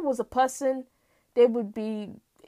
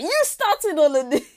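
louder, higher outburst; angry accusation; 4 semitones higher, 6 dB louder than usual. Acted. September backhanding March, angry accusation. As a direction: hurl the blame, hammed-up.

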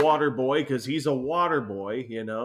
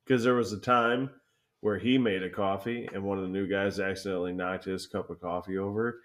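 ordinary talk

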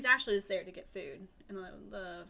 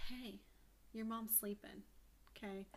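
soft slug voice; 11 dB quieter than usual. Acted. Slug answers at the windowsill.

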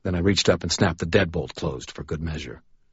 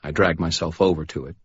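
usual speaking voice

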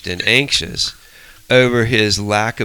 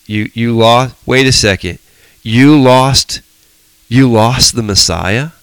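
mock disbelief; 3 semitones higher, 6 dB louder than usual. Mock questioning Mary and Joseph.

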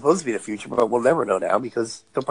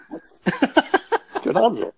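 baffled; 9 semitones higher, much slower than usual. Retold or imitated. Retold baffled reaction in theater.

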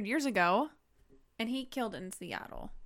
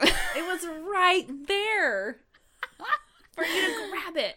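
laughing; 8 semitones higher, 8 dB louder, slower than usual. Real, not acted. Teasing friend about missed joke.

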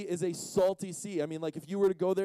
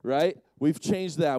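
usual speaking voice

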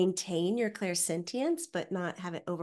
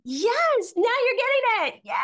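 excited praise; 8 dB louder than usual. Real, not acted.

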